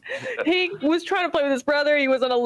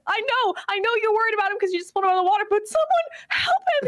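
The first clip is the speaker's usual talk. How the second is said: much higher, mock-tearful plea for someone to help; mock pleading; 10 semitones higher than usual. Begging the adults on screen to comfort the sobbing boy, much higher. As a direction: beg in a high whiny voice.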